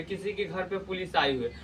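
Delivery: ordinary talk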